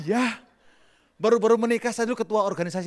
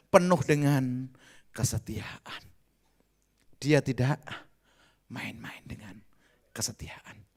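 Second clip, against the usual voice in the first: whispered emphasis; 6 semitones lower, slower than usual. Acted. Preacher stressing faithfulness to church, whisper. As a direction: drop to a whisper on kesetiaan.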